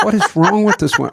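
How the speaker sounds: high, loud, half-laughing exasperation; exasperated disbelief. Retold or imitated. Man recalls reacting to his loud date, exasperated. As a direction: exclaim it in amused outrage.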